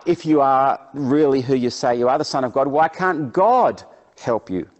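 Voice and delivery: loud, emphatic taunt; acted jeer of religious leaders; mocking challenge. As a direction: throw it out as a scornful dare. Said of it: "they also start mocking Jesus".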